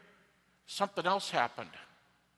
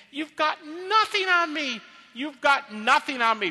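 high, forceful defiant cry; loud taunt; 8 semitones higher, 6 dB louder than usual. Shown as a demonstration. Paul taunting death that it has nothing on him, loudly defiant. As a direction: throw it at them, loud and high. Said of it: "So Paul's saying".

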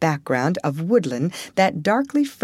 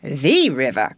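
"The river" with THE leaned on; correcting stress on THE; 4 semitones lower, 3 dB louder than usual. A narrator's reading. Rat corrects Mole about the river, stressed THE. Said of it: "corrected the rat".

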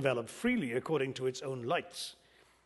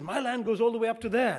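higher-pitched proud boast; 7 semitones higher than usual. Retold or imitated. Indian farmer showing American how far his land reaches, proudly. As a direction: boast as a proud landowner, voice lifted. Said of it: "the farmer says very proudly".

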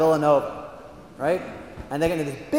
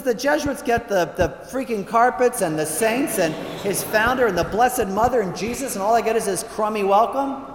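high, louder angry rant; angry complaint; 6 semitones higher, 4 dB louder than usual. Acted. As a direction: list the grievances, fuming. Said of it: "really ticked off and he's angry".

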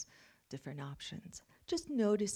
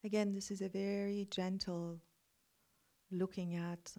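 guided meditation. Acted. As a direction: guide them into calm reflection.

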